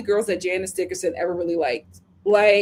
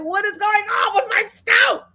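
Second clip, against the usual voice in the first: panicked mimic; 9 semitones higher, 3 dB louder than usual. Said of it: "she was like".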